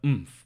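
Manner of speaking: loud mock lifting grunt; imitated strained "oomph"; effort grunt. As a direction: grunt as if hoisting something heavy. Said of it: "went to pick her up and went".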